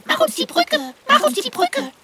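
very high, tiny calling mice; mouse voice. Acted. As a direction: squeal it like eager little mice. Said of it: "Oder die Mäuse riefen".

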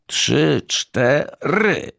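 high-pitched rallying count. A narrator's reading. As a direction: call the count for a joint push. Family counts down before pushing car. (wysokie, głośne odliczanie do pchania)

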